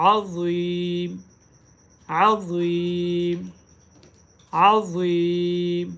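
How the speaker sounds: slow अज़ीम with a heavy emphatic z; अज़ीम drawn out, ज़ा made heavy. Shown as a demonstration. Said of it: "ज़ा को मोटा पढ़ें".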